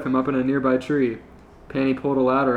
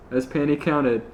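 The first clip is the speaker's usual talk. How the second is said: usual speaking voice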